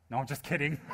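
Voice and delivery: breaking into a high, loud laugh; kidding, then crack up loudly; jokey line bursting into big laughter; joking laughter. Real, not acted.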